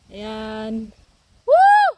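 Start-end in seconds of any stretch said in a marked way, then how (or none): 1.4-1.8 high, loud "woo" holler; calling out. Real, not acted. Vlogger hollers back to a cousin, calling out. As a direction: whoop out to someone far away. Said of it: "sinigawan ko".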